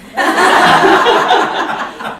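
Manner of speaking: breathy, very high burst of laughter. Real, not acted. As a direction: squeal with laughter.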